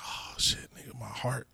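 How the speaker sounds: near-whispered, mock heart-struck; hushed overwhelmed reaction. Son reacting to mother's rare sweetness, hushed. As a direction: say it under your breath, moved.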